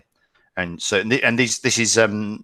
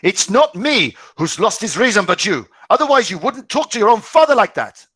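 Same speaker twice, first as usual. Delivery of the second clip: high, loud angry father; growing louder; 12 semitones higher, 6 dB louder than usual. Acted. Father shouting back at his son, louder. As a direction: raise the voice at the son. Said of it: "Herr Schneider's voice grew louder".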